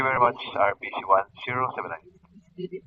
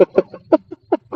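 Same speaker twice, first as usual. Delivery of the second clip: high burst of laughter; 9 dB louder than usual. Real, not acted. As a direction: laugh out loud at the joke. Laughs at woof woof joke.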